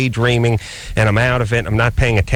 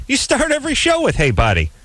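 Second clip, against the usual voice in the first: much higher teasing voice on the accusation; mocking friends; 11 semitones higher than usual. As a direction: tease him in a high mock voice. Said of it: "looking at me going".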